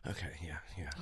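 low, very soft mumbled agreement; meek giving in. Retold or imitated. Friend acting how she calms once screamed back at, soft and meek. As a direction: imitate backing right down, barely audible.

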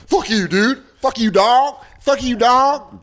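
high, put-on slangy tough-guy voice; mock a suburban kid acting street; imitating a nineties wannabe, last line slow; wannabe gangster voice. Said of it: "wigger lady".

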